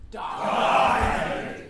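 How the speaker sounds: loud rasping shout. Shown as a demonstration. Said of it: "I'd like you to shout".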